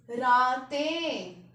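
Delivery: रातें stretched slowly, nasal ending ें clearly heard; slow nasal plural. Shown as a demonstration. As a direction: draw out रातें to show the nasal. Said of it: "हमें बिंदी सुन रही है". (रातें धीरे बोलकर बिंदी सुनाना)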